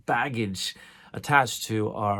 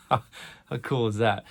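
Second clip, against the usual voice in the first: laughing; slower than usual. Real, not acted. Delighted rhetorical question.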